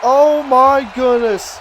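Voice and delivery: slow, loud amazed gasp of words. Real, not acted. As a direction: draw it out in awe. Reaction to the finish.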